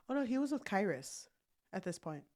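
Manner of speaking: ordinary talk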